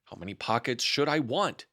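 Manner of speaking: ordinary delivery, nothing marked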